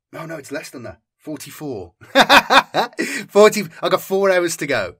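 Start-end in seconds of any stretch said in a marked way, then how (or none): 1.2-4.9 breaks into a real laugh. Real, not acted. Vlogger catching his own mistake, laughing. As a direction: laugh at the slip, then push through.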